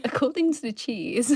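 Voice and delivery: giggling through the words; laughing. Retold or imitated. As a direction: say it while laughing. Joking quote while laughing.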